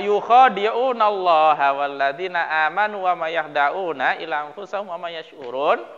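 measured, chanted Quran recitation. Acted. Verse quoted in recitation.

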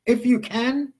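"if you can" pitched high, rising into a question. Retold or imitated. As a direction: throw "if you can" back as a pointed question. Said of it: "question mark".

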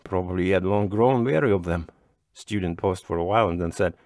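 ordinary delivery, nothing marked

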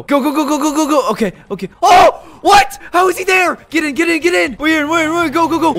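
high, panicky rush of words; frantic urging. Real, not acted. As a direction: urge everyone on as you flee. Frantic escape after being spotted.

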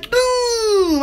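ordinary talk

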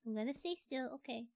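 ordinary delivery

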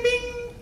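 high beep imitation; card reader beep. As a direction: sing out the scanner chime. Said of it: "your card will go bing".

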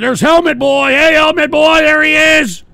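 high, loud calling out; real excited shout across a stream; excited hail. Real, not acted. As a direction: holler out a sighting, high and loud. Spots and hails Helmet Boy.

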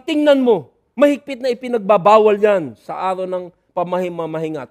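loud, sharp charge against the disciples; angry accusation. Acted. Pharisees angrily telling Jesus the Sabbath is broken. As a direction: bark it like offended Pharisees. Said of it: "magalit at makikita mo sa tono".

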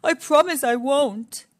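a lion promising earnestly, lightly voiced; pleading Lion. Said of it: "pleaded Lion".

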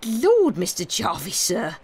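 rustic exclamation of dismay; exclaimed. A narrator's reading. Job protests at the gentleman's talk, exclaiming. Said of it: "exclaimed Job".

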